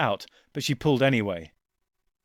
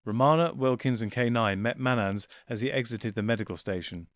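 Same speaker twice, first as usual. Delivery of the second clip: ordinary delivery, nothing marked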